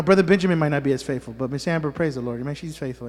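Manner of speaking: ordinary talk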